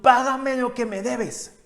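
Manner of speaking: a servant throttling his debtor, shouting; demanding shout. Acted. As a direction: choke the debt out of him. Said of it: "le ahogaba, diciendo".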